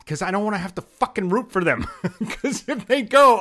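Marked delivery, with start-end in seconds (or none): 1.9-3.4 high, slow laugh through the words; real laughter at the thought of rooting for Boston. Real, not acted. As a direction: laugh it out in disbelief. Laughs at becoming a Celtics fan.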